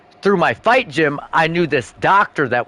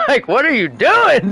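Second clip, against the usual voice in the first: ordinary talk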